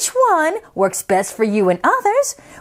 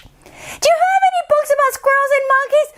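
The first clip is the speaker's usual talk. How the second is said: put-on much higher, fast child; 12 semitones higher, faster than usual. Isabella at the library, high child voice. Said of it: "loud in library".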